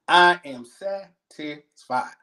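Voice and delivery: very slow "I am satisfied", each syllable pulled apart; every syllable stressed. Shown as a demonstration. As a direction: spell out all three syllables of "I am satisfied". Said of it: "All three syllables".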